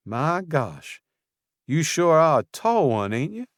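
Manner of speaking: Southern florist teasing the tall stranger; southern accent. A narrator's reading. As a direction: tease him Southern-style. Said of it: "an accent so southern".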